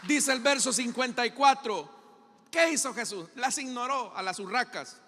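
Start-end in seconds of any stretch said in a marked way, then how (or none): none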